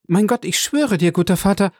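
high-pitched, earnest plea; mildly agitated granddaughter; anxious protest. Acted. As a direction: swear it to him, voice lifted.

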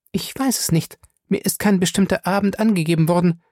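high-pitched woman recounting a scheme; Fay's character voice, serious. Acted. Fay tells Featherstone of Smith's plan, high and serious. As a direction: lay out the plot in a high lady's voice.

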